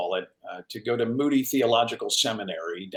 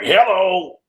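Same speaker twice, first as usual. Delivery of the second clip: rough trucker-lady phone hello, higher and louder; squatty trucker woman; 7 semitones higher, 7 dB louder than usual. Retold or imitated. Agent picking up the phone, gruff trucker hello. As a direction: answer the phone like a chain-smoking trucker. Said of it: "she sounded like, uh, a little squatty trucker".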